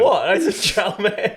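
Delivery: high mock-innocent protest; mock indignant. Indignant playful denial.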